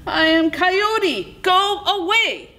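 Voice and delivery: very high-pitched, held-back scream. A narrator's reading. Coyote orders the rock away, screaming. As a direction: cry it high, stretching the words. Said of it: "he screamed".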